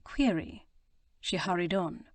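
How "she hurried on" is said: usual speaking voice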